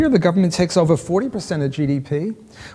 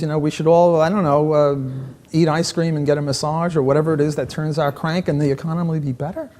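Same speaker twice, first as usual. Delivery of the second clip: ordinary delivery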